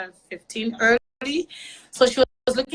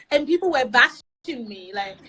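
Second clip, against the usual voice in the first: ordinary talk, nothing marked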